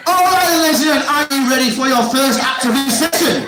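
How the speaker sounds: high, loud hyped-up announcement; rally the room like a holiday entertainer; mock activity host. Host rousing the crowd for an activity session, mock excitement.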